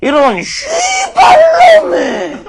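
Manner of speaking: high, slow shout in a thick Jeolla accent; Jeolla dialect curse. Shown as a demonstration. Lecturer demos the Jeolla curse to the audience, high slow Jeolla-accent shout. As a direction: bellow it out Jeolla style, long and high. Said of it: "이렇게 씁니다".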